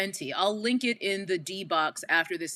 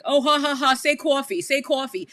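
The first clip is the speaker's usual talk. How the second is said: loud, higher-pitched mock laughing taunt; teasing classmates; 6 semitones higher, 5 dB louder than usual. College crowd teasing a Queens newcomer's accent, mock taunting. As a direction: imitate people goading someone over an accent. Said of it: "everyone's just like".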